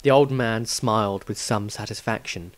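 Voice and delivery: usual speaking voice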